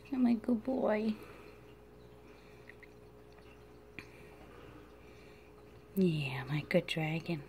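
hushed, breathy whisper to a sleepy pet; soft whisper. Real, not acted. Owner whispers to her drowsy dragon, soft whisper. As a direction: whisper so he drifts off.

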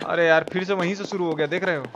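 ordinary talk

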